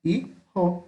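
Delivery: hi - jo with a clear pause between; syllable separation. Shown as a demonstration. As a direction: split hijo in two. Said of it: "separação de sílaba".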